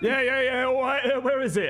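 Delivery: flustered NPC. Acted.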